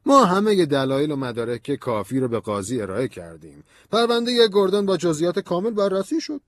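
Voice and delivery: high, emphatic colonel's voice; official, defensive colonel. A narrator's reading. Colonel defending the Gordon case, firm and high-pitched.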